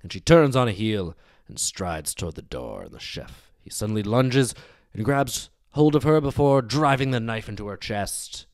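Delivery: usual speaking voice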